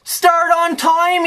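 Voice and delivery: high, drawn-out mocking quote; exasperated quote, pitched high; mock quoting. Mockingly quotes team's instructions.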